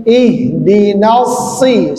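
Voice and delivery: ihdinas si drawn out slowly, si thick; slow model. Shown as a demonstration. Teacher models the heavy si for student.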